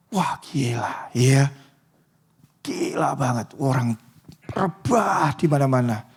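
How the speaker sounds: hushed, awestruck murmur; a proud heart whispering to itself; awed whisper. Retold or imitated. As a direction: whisper it like secret pride. Imagined proud thoughts, whispered.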